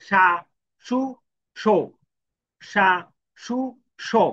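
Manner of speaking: সা, সু, সো said as sha, shu, sho, one syllable at a time; clear syllable drill. Shown as a demonstration. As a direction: sound out সা সু সো carefully for learners. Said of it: "তাহলে কি?".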